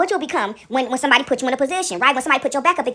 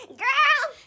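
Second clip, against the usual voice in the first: high laugh; 12 semitones higher than usual. Real, not acted. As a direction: squeal with laughter. Laughing at her own joke.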